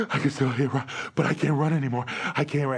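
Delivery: mock winded, gasping between phrases; imitating an exhausted runner; out of breath. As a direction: puff the words out quickly. Exhausted runner speaks while walking.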